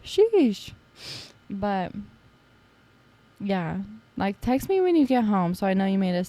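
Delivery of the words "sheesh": mock exasperation. Real, not acted. Exclamation after "that girl.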